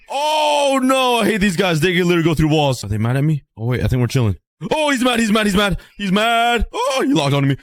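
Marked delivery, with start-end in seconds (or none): 4.7-7.5 high, rapid alarmed repeats; panicked alarm. Real, not acted. Player panicking as an enderman locks on. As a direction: blurt it out as the mob turns. Mob turns hostile.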